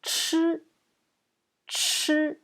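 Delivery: "Chi" with curled tongue and a puff of air; aspirated CH. Shown as a demonstration. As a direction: push extra air through "Chi" on a high, level pitch. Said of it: "avec plus d'air".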